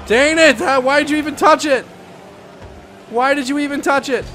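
high-pitched whiny protest; frustrated gamer cry; exasperated complaint. Real, not acted. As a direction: voice up, demanding an answer from a teammate. Teammate spoils a shot.

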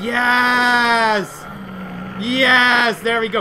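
big excited shout; triumphant shout. Real, not acted. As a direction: cheer the drop. Gets an ender pearl.

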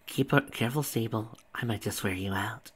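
plain reading voice

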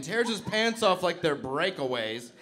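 ordinary talk, nothing marked

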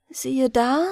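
usual speaking voice

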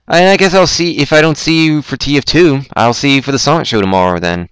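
ordinary talk, nothing marked

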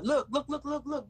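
high, loud repeated calls; calling out. Real, not acted. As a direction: grab everyone's attention. Calling for attention.

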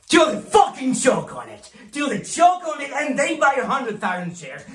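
high, hard-driving battle roar; furious shout. Acted. Boss urges brokers to choke clients with stock, furious shout. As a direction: explode into a high, loud yell. Boss urging aggressive selling.

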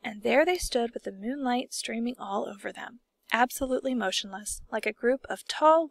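ordinary delivery, nothing marked

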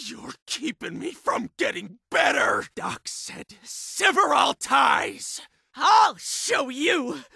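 agitated patient lashing out at voices; frantic, erratic outburst; tormented, deranged shouting. Acted. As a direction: shout as if cornered and losing your mind.

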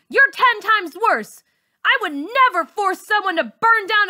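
very high, loud defiant yell. Acted. Girl finally yelling back at her bully, high and loud. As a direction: stand up and scream your pride. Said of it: "I yell".

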